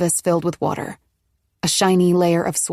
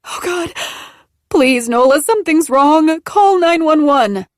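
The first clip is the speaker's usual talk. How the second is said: much higher and louder, frantic; panicked plea; 10 semitones higher, 6 dB louder than usual. Acted. Bleeding Maggie panics and begs Nola to call. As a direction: scream for help in terror.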